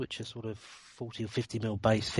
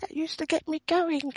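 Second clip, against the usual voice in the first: Liz Taylor. Acted. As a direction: squeal it out, way up high. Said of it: "my very own Liz Taylor".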